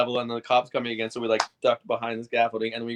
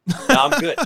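high loud refusal; 10 dB louder than usual. Retold or imitated. Refusing the extension ladder.

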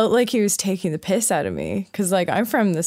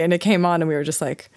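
usual speaking voice

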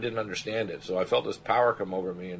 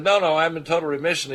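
joyful announcement; 8 semitones higher, 7 dB louder than usual. Retold or imitated. Patient's joyful call from remission.

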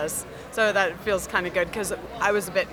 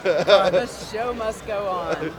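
theatrical; much slower than usual. Acted. Cosplay banter, stage actor lines.

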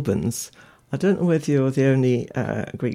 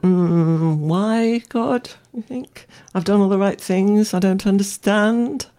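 higher, imitated bewildered questioning of God; bewildered plea to God; 6 semitones higher, slower than usual. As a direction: voice a hurt, puzzled prayer, half-acted. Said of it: "You must have, in your mind, thought".

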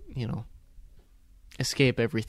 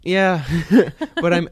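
bursting out laughing on yeah; a real laugh breaking through; 6 semitones higher, 8 dB louder than usual. Real, not acted. Laughs at own weird noises.

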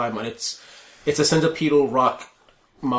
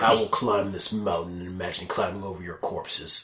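low, softer brooding monster voice; barbarian; 7 semitones lower, 6 dB quieter than usual. Acted. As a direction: mutter dark threats like a beast. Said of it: "the cries of the climb mon making words".

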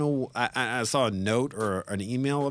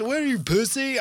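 high, mocking tough-guy jab; mock macho taunt; 12 semitones higher than usual. Retelling a guy's taunt, mock macho sneer. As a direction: sneer it like a bully.

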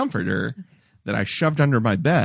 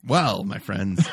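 ordinary delivery

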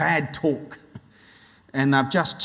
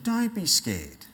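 slow, soft, higher reassurance; tender; 5 semitones higher, 4 dB quieter, slower than usual. Acted. God to his children, tender and reassuring. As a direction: soothe a child gently. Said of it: "does say tenderly to his children".

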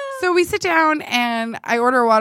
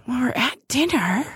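whispered deadpan. Retold or imitated. Deadpan retort to date.

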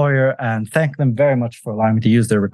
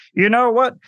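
mock opinion; 5 semitones higher, slower than usual. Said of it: "I could just go here and say".

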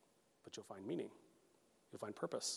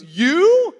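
very high, very loud disbelief; hammed-up shriek of astonishment; incredulous outburst; 23 dB louder than usual. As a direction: imitate a coach utterly floored by the news. Coach reacts to pastor plan.

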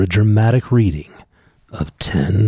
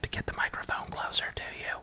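whisper; 19 dB quieter than usual. Real, not acted. Dad whispering into the mic.

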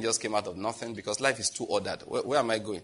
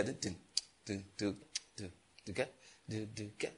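hummed beat; 2 semitones lower, 14 dB quieter, slower than usual. Retold or imitated. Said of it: "your head is going like this".

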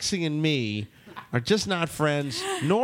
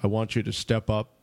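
lightly voiced presidential cadence, lower and halting; Obama impression; 5 semitones lower than usual. Host serving shots to ladies, mild Obama impression. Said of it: "I'm gonna do it like Obama".

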